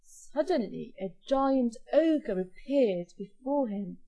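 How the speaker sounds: ordinary delivery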